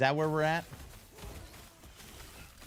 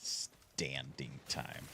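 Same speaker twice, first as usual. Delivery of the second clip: whisper; 11 semitones lower, 13 dB quieter, slower than usual. Real, not acted. Conspiratorial aside after fight.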